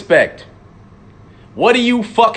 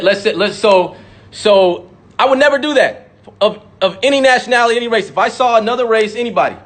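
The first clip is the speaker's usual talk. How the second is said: ordinary talk, nothing marked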